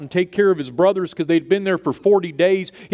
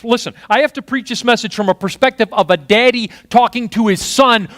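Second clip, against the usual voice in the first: ordinary talk, nothing marked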